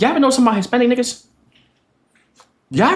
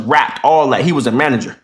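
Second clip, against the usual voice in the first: ordinary delivery, nothing marked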